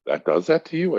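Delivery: high pitched imitation of his wife, amazed; mock surprised wife. Retelling the wife asking about cannabis, high mock surprise. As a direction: squeak it out like the stunned wife.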